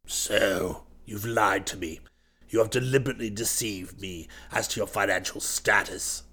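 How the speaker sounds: very low, quiet, contained fury; seething old duke. A narrator's reading. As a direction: accuse the nephew in a hushed, deep voice. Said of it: "Old Chiswick foamed at the mouth".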